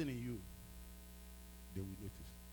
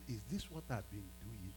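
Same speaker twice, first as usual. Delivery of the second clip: remorseful; 4 semitones lower, 11 dB quieter than usual. Retold or imitated. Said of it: "I said".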